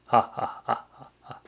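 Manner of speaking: dry, spaced-out mock laughter; fake laugh. Streamer reacting to squirrel pun, mock laugh. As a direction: pretend to laugh, fading off.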